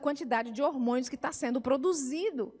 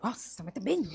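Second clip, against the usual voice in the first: put-on grumbling teen; 4 semitones lower than usual. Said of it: "Resmungando e tal".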